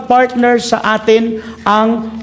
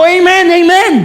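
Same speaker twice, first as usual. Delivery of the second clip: a touch of an excited, louder amen; 8 semitones higher, 4 dB louder than usual. Shown as a demonstration. Preacher shows the crowd an excited amen. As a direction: show them how to say amen excitedly. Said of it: "na-excite ka".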